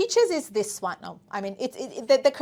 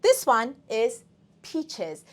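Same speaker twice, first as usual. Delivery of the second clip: usual speaking voice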